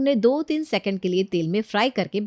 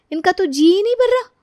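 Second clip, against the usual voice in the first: exasperated complaint; 10 semitones higher, 6 dB louder than usual. Acted. Priya complains about in-laws.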